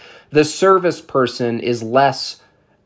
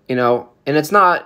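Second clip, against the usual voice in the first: normal talking voice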